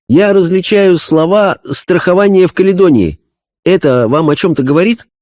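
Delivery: high, earnest medium voice; a spirit medium describing a vision; seance medium. Acted. (голос медиума на сеансе)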